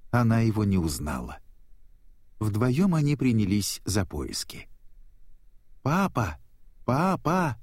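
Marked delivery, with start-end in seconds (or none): 5.8-7.6 child's searching cry, voiced lightly; calling out. A narrator's reading. Nemo calls for his lost father, mildly crying out. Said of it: "кричал Немо".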